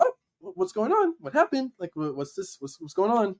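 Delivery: a hint of a flustered older woman calling out; startled godmom. Godmom hearing the shout at home, alarmed and high.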